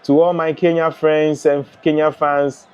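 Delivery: ordinary talk, nothing marked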